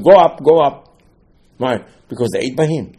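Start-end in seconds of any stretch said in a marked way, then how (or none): 0.0-1.0 louder, urging upward call; angels calling out. Retold or imitated. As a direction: call him up with eagerness. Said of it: "they told Moshe Rabbeinu".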